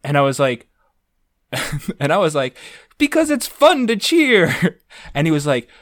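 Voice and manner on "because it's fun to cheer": high, drawn-out gleeful reply; slow, squealed mock delight; naive enthusiasm. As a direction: answer with hammed-up innocent joy. Retold naive answer to teacher.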